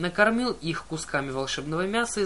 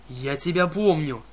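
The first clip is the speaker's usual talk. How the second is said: angry roar; 4 dB louder than usual. A narrator's reading. Enraged cyclops shouting.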